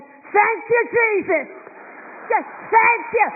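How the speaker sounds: high, loud cries of thanks; retold screaming praise. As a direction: shout it out like a mother overjoyed. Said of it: "She was screaming so bad".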